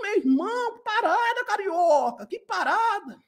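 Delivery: high, drawn-out exaggerated Rio accent; over-the-top singsong Rio carioca greeting; mock carioca accent. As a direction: ham up a carioca, stretching vowels high. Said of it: "tenho que falar como carioca".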